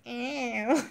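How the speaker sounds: a hint of the Carly B call, with a laugh in it. Fooling for the stream chat, a light Carly B ow. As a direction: toss out her signature ow lightly. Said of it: "In my Carly B voice".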